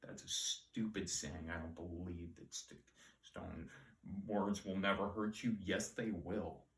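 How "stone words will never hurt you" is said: usual speaking voice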